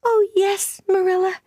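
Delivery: put-on very high, overjoyed girl's cry; Anne, crying out. A narrator's reading. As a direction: exclaim with happy emotion in a child's voice. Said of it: "cried Anne".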